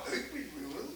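low, soft affected reply in a put-on voice; mimicking a hometown boy's new airs; mock New York put-on. Returned from New York, greeting an old friend in a put-on manner.

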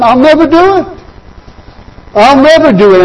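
lightly voiced brash disciple; boastful Peter.

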